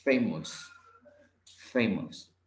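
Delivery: "Famous." said alone, each time with a falling end; pronunciation demo. Shown as a demonstration. Teacher shows student how it is said, careful model of "Famous". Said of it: "Digámoslo así".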